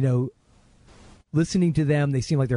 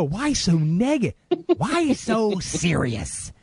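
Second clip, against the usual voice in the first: Joker impression; 5 semitones higher, much slower than usual. Quoting the Joker line.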